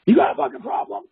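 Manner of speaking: high, loud mock yell; puffed-up confrontation. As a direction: mimic an aggressive man squaring up. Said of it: "puffing his chest".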